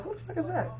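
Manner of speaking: high, very soft startled question; hushed alarm. Retold or imitated. Hearing a knock, hushed high startled question. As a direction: whisper it at the door.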